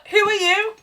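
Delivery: high-pitched imitation of a belligerent drunk; drunk man's challenge. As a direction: repeat the drunk's indignant question in a high mock voice. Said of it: "he's like".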